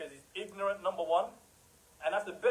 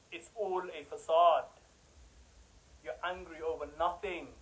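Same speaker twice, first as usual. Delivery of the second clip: normal talking voice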